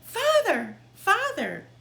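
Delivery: very high, loud yell for father. Acted. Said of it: "yelled Tom".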